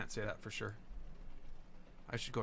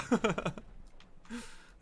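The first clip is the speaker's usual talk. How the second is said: laughing; 8 semitones higher than usual. Real, not acted. Laughing at stream delay.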